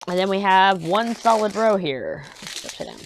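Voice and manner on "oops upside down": whispered aside, under breath; muttered whisper. Real, not acted. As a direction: whisper it to yourself.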